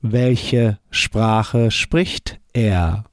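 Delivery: very slow "Welche Sprache spricht er?"; deliberately slowed German; slow model answer. Shown as a demonstration. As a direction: stretch it out for the class. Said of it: "How would you say".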